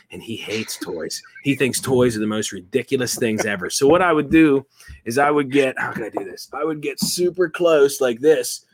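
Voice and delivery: normal talking voice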